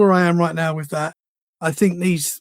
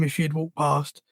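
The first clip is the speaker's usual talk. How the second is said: ordinary delivery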